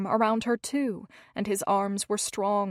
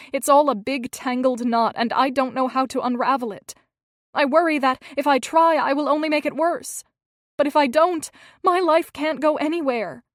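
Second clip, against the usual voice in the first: climbs from worried to loud, higher anguish; rising distress; 4 semitones higher, 7 dB louder than usual. A narrator's reading. Hazel to Gilbert, confessing her tangled worries, building to a loud higher outburst. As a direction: let the words spill out, building louder and higher.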